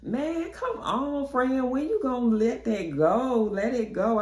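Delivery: high, whiny plea to drop it; pleading friend. Retold or imitated. Friend begging her to drop an old grudge, high. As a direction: beg her to let it go, high. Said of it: "And she like".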